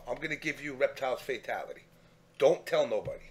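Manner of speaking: hushed, quick, secretive aside; conspiratorial hush. Retold or imitated. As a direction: let him in on a secret, softly. Secretly sharing fatality to kid.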